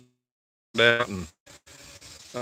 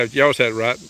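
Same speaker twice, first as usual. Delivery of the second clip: ordinary talk, nothing marked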